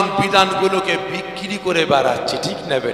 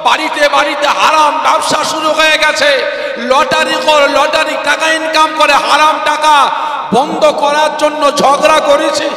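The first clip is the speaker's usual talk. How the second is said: high, loud, relentless sermon shouting; fiery preaching; 11 semitones higher, 7 dB louder than usual. Acted. Preacher shouting at the congregation in a full-force sermon. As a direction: preach it at full force, hammering each sin.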